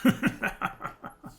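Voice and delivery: soft, breathy chuckle under the breath; quiet laugh. A narrator's reading. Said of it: "Travis laughed again quietly".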